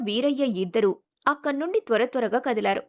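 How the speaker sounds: usual speaking voice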